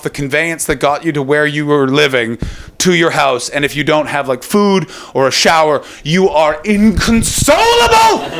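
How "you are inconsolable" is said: drawn out, slow, overblown misery; mock despair. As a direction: stretch it slowly like a tragedy. Comic complaint about travel hunger.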